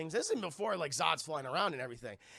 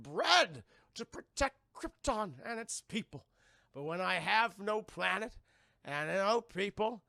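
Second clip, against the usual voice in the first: Zod; 3 semitones higher than usual. Acted. Zod lamenting his lost people, hammed-up anguish. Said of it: "Zod's just like".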